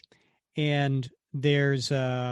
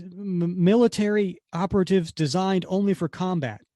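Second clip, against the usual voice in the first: fearful answer; 6 semitones higher, 4 dB louder than usual. Retold or imitated. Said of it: "says it in, in fear in his voice".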